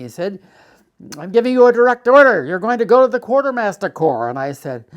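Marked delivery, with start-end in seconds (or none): 1.2-4.3 loud, high, fast officer's yell; re-enacted furious yelling at a subordinate; screamed order. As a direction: bellow a command as an enraged major. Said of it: "He screamed and yelled at me".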